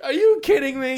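high, loud outraged disbelief; a golfer outraged as the wind turns his drive. Retold or imitated. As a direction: shriek it, can't believe it.

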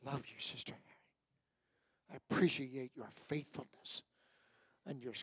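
soft tender whisper. Real, not acted. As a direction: whisper it to her fondly. Tender aside to church elder.

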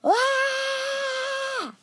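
sustained shrieking scream; long high scream. Acted. Narrator falling into a never-ending pit, long scream. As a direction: scream loudly on one held high note. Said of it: "Then I said calmly".

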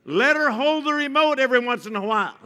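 loud, high mock advice; mock generous advice. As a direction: hand out the advice with irony.

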